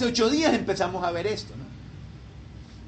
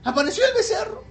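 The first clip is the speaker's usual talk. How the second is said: pitched up high and quick, as if marvelling; mock amazement; 10 semitones higher, faster than usual. Aaron excusing himself to Moses, in hammed-up amazement. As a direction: sell the golden calf as a wonder that just appeared.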